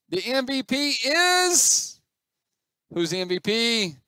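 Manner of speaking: high, loud, drawn-out award announcement; hyped-up MVP reveal; mock announcer. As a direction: stretch it out for suspense like an announcer.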